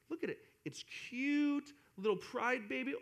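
dote on a cute little newborn; mock-adoring coo; cooing at baby. Cooing over an imaginary baby.

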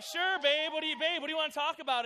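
loud, high and fast, nearly shouting; shouted sure babe. Retold or imitated. Boyfriend loudly answering girlfriend before the breakup. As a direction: bellow it out, overeager. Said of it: "So I'm like".

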